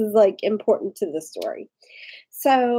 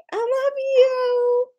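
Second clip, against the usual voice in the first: high sweet squeal; slower than usual. Real, not acted. Greeting friend.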